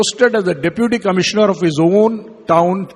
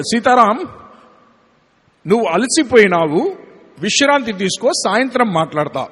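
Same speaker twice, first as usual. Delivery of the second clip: higher, fast Telugu dialect of Swami; Swami's Telugu dialect; 4 semitones higher, much faster than usual. Retold or imitated. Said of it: "bring in the Telugu dialect of Swami".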